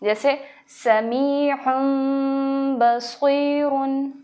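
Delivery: very slow समीउन बसीरुन with a humming meem; ghunna recitation. Shown as a demonstration. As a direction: hold the nasal hum before बसीरुन. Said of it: "इक़लाब माअल गुन्ना इसको बोलेंगे".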